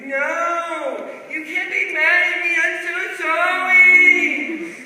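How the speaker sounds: high, slow, loud whining wail; mock crying child. Niece wailing an apology to her aunt, high and slow. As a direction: wail like a four-year-old caught. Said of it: "She's like".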